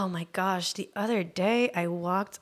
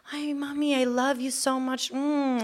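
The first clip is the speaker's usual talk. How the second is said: high, sing-song creepy come-on; leering catcaller; 5 semitones higher than usual. Retold or imitated. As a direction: imitate a street creep cooing at a woman. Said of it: "He comes up to me and he's like".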